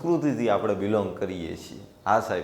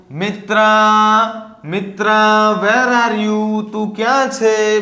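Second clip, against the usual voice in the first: crying out loudly; 8 semitones higher, 7 dB louder than usual. Acted. Reshma searching for her missing Mitra, crying out loudly. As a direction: call out loud and high, stretching the words. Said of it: "cried loudly".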